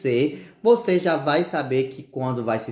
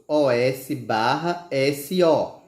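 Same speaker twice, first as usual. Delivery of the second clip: slow letter-by-letter spelling of osso; slower than usual. Shown as a demonstration. Teacher spelling osso slowly.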